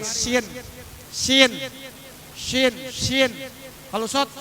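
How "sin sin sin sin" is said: thin "sin" through widened lips, pitched higher; spread lips. Shown as a demonstration. Teacher drilling the letter sin, "sin" with spread lips, higher. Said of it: "bibir kita dilebarin".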